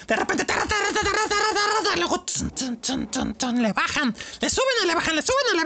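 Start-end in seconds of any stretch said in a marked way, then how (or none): 0.4-1.8 sung-out mock drum beat "tarra, tarra"; imitating odd drumming. Shown as a demonstration. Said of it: "tocan muy raro". 1.8-3.6 "tun, tun" dropped much lower and softer like a bass drum; low drum sounds. Shown as a demonstration. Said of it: "tocan muy raro".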